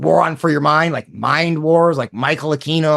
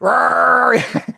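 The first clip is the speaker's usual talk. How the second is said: high, drawn-out imitation roar; mock ranting roar; 9 semitones higher than usual. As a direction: roar like a ranting host, mockingly. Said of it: "he'd do that".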